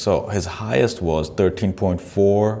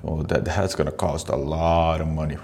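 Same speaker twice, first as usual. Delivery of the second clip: normal talking voice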